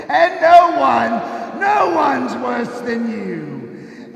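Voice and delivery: slow loud accusing shout; slow roaring judgement; booming condemnation. Acted. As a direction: thunder the verdict at him. Spirit condemns Scrooge.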